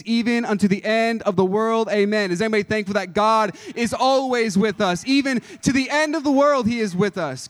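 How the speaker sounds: loud, building preacher's cadence rising to a peak; full-force revival preaching. Acted. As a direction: hammer each I am home to the congregation. Preaching build to Great Commission.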